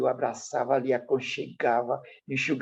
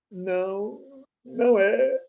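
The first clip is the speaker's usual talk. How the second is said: criança chorando, voz bem mais alta; choro imitado; 10 semitones higher, much slower than usual. Retold or imitated. (menino chorando, voz aguda)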